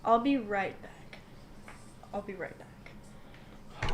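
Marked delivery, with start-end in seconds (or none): 2.1-3.9 slow, soft whisper; hushed aside. Acted. Telling the others about leaving briefly, slow whisper. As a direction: draw it out under the breath. Same line repeated, hushed.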